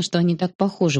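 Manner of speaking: ordinary delivery, nothing marked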